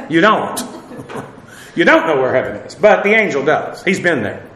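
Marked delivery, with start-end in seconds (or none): none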